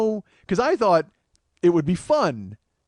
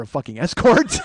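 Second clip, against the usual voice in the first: incredulous punchline; 6 dB louder than usual. Retold or imitated. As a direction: let "escort" burst out incredulously. Punchline delivered with rising incredulity.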